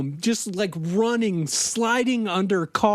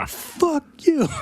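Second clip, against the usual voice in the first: mock angry gang yell; 8 semitones higher than usual. Gang yelling at Mike.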